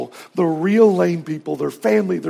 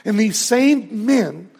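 ordinary talk, nothing marked

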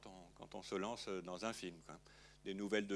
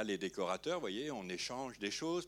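ordinary talk, nothing marked

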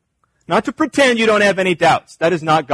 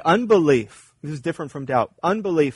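normal talking voice

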